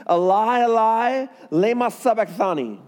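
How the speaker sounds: high, loud cry to God. Acted. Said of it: "cried out with a loud voice".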